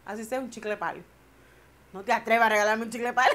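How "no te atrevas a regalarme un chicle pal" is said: playful, loud, quick warning; half-joking "don't you dare"; mock threat. To her husband about a cheap gift, a loud mock warning.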